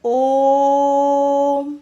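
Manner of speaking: ओम held very long and loud; ओम sung out, long and steady; drawn-out Om. Shown as a demonstration. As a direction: stretch ओम slowly as a pluta vowel. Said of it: "जैसे ओम".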